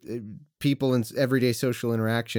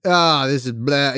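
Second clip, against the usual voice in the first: scoffing dismissal; 2 semitones higher, 6 dB louder than usual. Retold or imitated. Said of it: "that just kinda says".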